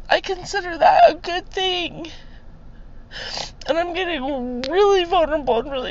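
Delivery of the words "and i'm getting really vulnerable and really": high, choked-up vulnerable voice; fighting back real tears while testifying; tearful. Real, not acted. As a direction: voice rising as real emotion takes over. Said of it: "I'm just a blubbering".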